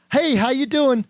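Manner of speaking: beam it out; bright cheerful demo; upbeat greeting. Shown as a demonstration. Acted greeting and cheerful reply.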